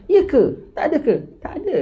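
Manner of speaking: high, incredulous questions with a laugh at the end; mock disbelief. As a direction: play both sides of an amazed exchange, pitch way up. (pertanyaan hairan yang dibuat-buat, nada sangat tinggi)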